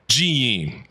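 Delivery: ordinary delivery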